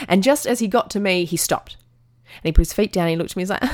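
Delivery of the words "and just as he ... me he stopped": ordinary talk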